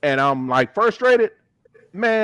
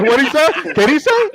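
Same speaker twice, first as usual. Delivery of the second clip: louder, higher puzzled asking, laughing; baffled; 7 semitones higher, 4 dB louder than usual. Retold or imitated. Laughing at a friend's Spanish, loud puzzled question. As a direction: ask what he said in disbelief. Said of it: "I said".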